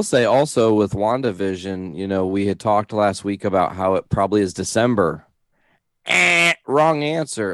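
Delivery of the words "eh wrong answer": drawn-out buzzer noise, then verdict; mock quiz-show rejection; wrong-answer buzzer. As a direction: imitate a game-show buzzer, pitch raised. Game-show buzzer for wrong guess.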